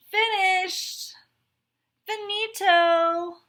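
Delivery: high, loud triumphant calls; gleeful finish-line yell; victorious shout. Acted. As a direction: crow about coming in first. Straw races to finish first.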